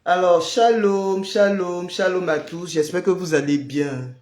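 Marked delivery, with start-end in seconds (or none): none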